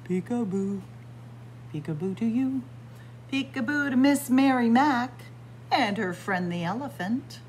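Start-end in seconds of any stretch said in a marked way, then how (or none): none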